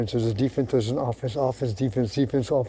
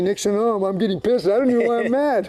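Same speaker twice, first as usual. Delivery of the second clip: mock complaining; 6 semitones higher than usual. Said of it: "and then you're saying".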